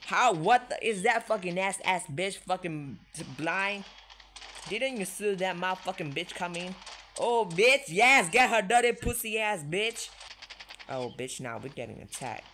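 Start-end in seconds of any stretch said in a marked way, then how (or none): 0.1-6.7 loud, higher furious ranting; angry yelling. Real, not acted. Player yelling at a teammate who missed the enemy, furious. 7.2-10.1 loud, high triumphant yelling; excited cheer. Real, not acted. Player cheering as the enemy gets hit, excited yelling.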